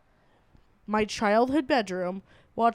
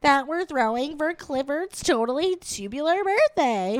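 put-on higher, quick Valley Girl accent; 5 semitones higher than usual. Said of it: "we're like".

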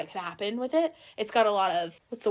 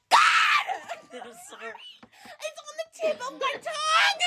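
exasperated laughing; 14 semitones higher than usual. Real, not acted. Still can't find the word.

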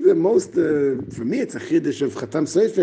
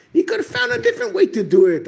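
animated protest; 12 semitones higher, faster than usual. Real, not acted. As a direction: rush it out, worked up. Argues Noach deserved an easier way.

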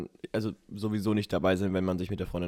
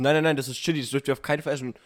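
loud warning; 4 semitones higher, 5 dB louder, faster than usual. Retold or imitated. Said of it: "Er sagt so".

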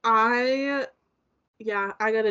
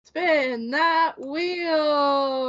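slow, stretched game show call; drawn-out announcer call; 4 semitones higher, much slower than usual. Acted. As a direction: call it out like a host.

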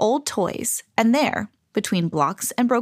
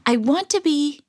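high, hesitant, left hanging; high and trailing into indecision; breaking off; 6 semitones higher than usual. A narrator's reading. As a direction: raise "be" and leave it unfinished. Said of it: "Alexander stopped".

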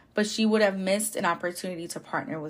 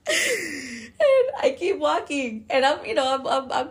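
laughing; 6 semitones higher, 5 dB louder, slower than usual. Real, not acted. Laughing-crying at own story.

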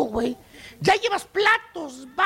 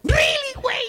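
outraged shout; 6 semitones higher than usual. Real, not acted. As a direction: yell "really" in outrage. Exaggerated outrage at prices. (grito indignado)

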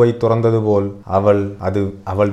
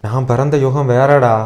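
higher, louder wail of protest; pained, raised-voice retort; anguished grandmother; 6 semitones higher, 6 dB louder than usual. A narrator's reading. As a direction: cry it out as an old woman from another era.

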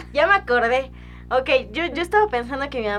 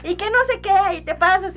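much higher, louder scolding mother; angry mom; 11 semitones higher, 6 dB louder than usual. Retold or imitated. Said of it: "Mi mamá se enojó". (mamá enojada regañando, mucho más aguda)

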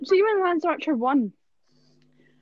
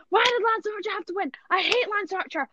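higher, hammed-up raging whine; replaying the tantrum, pitched higher, ending in a yell; mock raging; 9 semitones higher than usual. Retelling watching Lance Archer win, higher hammed-up raging whine. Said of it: "I was raging".

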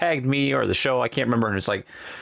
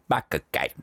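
Michael Caine impression; 8 semitones lower than usual. Shown as a demonstration. Host telling listeners how to play along, lower Caine accent. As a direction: say his name like he would. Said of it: "you just have to say".

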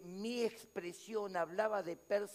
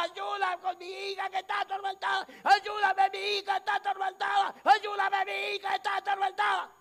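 higher, loud pleading shout; the Syrophoenician woman begging Jesus loudly; desperate cry; 11 semitones higher, 7 dB louder than usual. Acted. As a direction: cry out for your tormented daughter. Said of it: "la sirofenicia gritaba".

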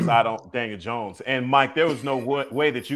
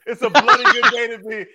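laughing through a mock British accent; half-laughing British accent, higher and louder; 10 semitones higher, 7 dB louder than usual. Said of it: "the second time you tried to do a British accent".

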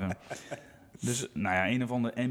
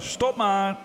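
high, loud shout to stop; retold shout; 8 semitones higher, 6 dB louder than usual. Mechanic yells to the driver turning the wheel, retold shout. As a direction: imitate the mechanic yelling to halt. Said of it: "ik hoor hem al schreeuwen".

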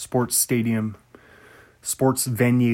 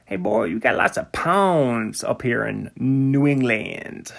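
put-on New England accent. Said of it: "that's my New England accent".

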